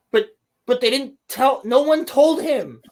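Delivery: high, incredulous rant; exasperated. Real, not acted. Exasperated rant about uninformed character.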